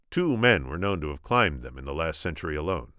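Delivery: plain reading voice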